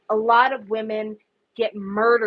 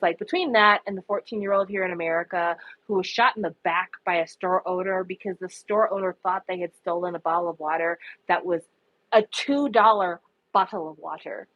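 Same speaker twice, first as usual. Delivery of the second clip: ordinary talk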